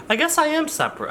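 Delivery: high, fast reply to himself; second self voice. Pretend. Answers himself in another voice.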